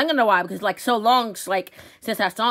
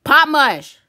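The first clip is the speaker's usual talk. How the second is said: loud, raised-pitch shout; calling out; 4 semitones higher, 8 dB louder than usual. Acted. Birthday kid calling the runaway friend, calling out. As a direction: holler the name for someone out of sight.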